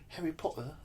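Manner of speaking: a hint of a British accent, voicing the girl in the diner; British girl saying Harry Potter. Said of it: "I just like the way British people say that word".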